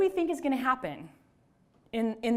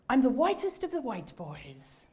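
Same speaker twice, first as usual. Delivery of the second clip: slow, mock British accent; fake British accent. Student mock-celebrating his whiteness to a researcher, fake British accent. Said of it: "abruptly took on a fake British accent".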